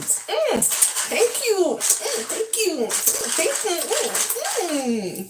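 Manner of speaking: very high squealed mm thank you; high cutesy thank you; squeaky thanks. Real, not acted. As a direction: squeal it cutely.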